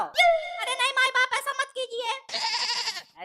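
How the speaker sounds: pleading convict. Acted. Convict begs again, drawn out.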